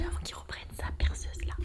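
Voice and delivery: hushed whisper, voice off; quick whispered aside. Real, not acted. Hurrying off before neighbour drills.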